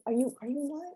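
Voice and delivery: high and soft, quick halting question; timid question. Retold or imitated. Caribbean kid shyly asking elder women if they are in line, soft and high. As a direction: barely dare to ask it.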